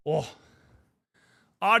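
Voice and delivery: normal talking voice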